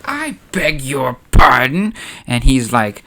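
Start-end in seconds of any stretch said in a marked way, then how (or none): 0.0-1.9 affronted. Acted. Mothma affronted in acted quarrel.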